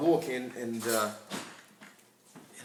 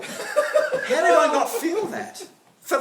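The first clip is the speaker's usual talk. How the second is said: laughing disbelief; 11 semitones higher, 5 dB louder, slower than usual. Real, not acted.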